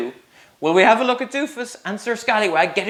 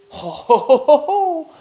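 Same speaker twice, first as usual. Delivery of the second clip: hearty ho ho ho, rising higher; jolly mock laugh; 11 semitones higher, 3 dB louder than usual. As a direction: laugh big for the kids, climbing up. Reader laughs at the knight's jump.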